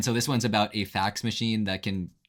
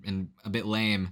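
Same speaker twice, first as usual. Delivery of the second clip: ordinary talk, nothing marked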